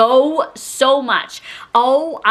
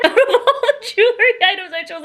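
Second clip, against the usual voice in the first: laughing; 9 semitones higher than usual. Real, not acted.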